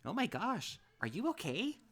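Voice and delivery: high, loud worried TJ voice; alarmed concern. Acted. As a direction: fuss in a panic over a fallen friend. TJ rushes to fallen friend.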